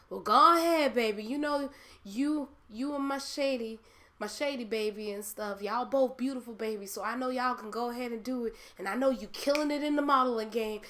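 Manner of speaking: high, loud, encouraging auntie voice; great aunt blessing the modeling, high and loud; imitating auntie. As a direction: cheer her on like a proud old auntie, up high. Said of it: "She's like".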